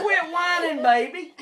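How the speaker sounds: mock scolding. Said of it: "I said".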